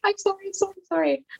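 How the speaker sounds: high, repeated mock apology; pleading sorries pitched well up; retold frantic apology. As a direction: imitate yourself apologizing helplessly in labour. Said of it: "also saying sorry at the same time".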